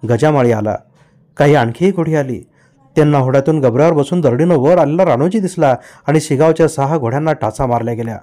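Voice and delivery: ordinary delivery, nothing marked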